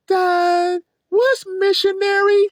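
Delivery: put-on high, slow little kid voice; innocent child. Kid asking dad about the album in the store, high innocent child voice. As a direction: ask it like a curious small child.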